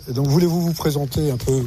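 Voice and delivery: usual speaking voice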